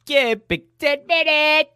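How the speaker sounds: very high sung show call; high jingle call. Pretend. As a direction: squeal it like a bumper jingle.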